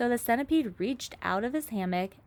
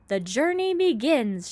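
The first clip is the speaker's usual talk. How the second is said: louder, higher proud shout; 6 semitones higher, 6 dB louder than usual. A narrator's reading. Centipede proclaims the journey to the crew, proud shout. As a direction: announce it to all. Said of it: "shouted the centipede".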